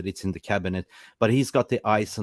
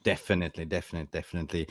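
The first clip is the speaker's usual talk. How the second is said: trailing off quieter and faster; fading; 6 semitones lower, 8 dB quieter, much faster than usual. Real, not acted. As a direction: repeat it softer each time. Agreeing, fading repetition.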